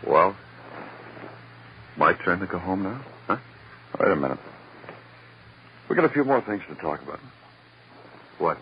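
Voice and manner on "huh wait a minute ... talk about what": ordinary talk, nothing marked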